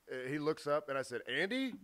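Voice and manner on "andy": tentative, rising question at normal volume; higher-pitched double-take on a familiar face; is that really you?; questioning recognition. Retold or imitated. Retold surprised recognition of a friend.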